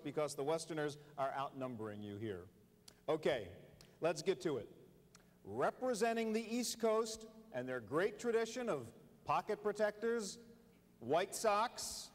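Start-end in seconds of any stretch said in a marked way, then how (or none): 5.4-12.1 drawn-out names like a stadium announcer; sports announcer intro. Acted. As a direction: boom the player names at a big game.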